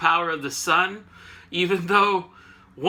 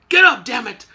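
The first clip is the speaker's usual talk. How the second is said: loud, raised imitation of a wake-up order; mock shout; 5 semitones higher, 9 dB louder than usual. Professor rousing knocked-out Iceman, mock impatient shout. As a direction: yell it as an impatient retold command. Retold telepathic wake-up call.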